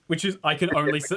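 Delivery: ordinary delivery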